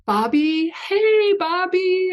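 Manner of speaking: high, stretched-out teasing call; slow, very high mock-chummy singsong; prank-call greeting. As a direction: imitate a prankster's overfamiliar hello. Imitating Fred's prank call to critic.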